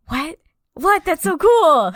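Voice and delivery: high, loud re-enacted amazement; hammed-up thrilled discovery; amazed excitement. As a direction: gush in giddy disbelief. Retells her amazed reaction.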